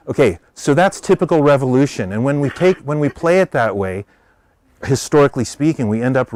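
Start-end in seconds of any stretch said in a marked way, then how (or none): none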